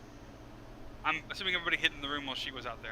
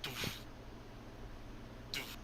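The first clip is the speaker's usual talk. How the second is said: imitated footstep thuds; 15 dB quieter than usual. Game master imitates a character walking off, soft thuds. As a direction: softly sound out spaced thuds. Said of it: "And then he goes".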